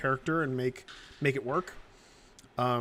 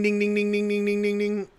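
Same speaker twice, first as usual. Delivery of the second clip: imitated ding sound; 5 semitones higher than usual.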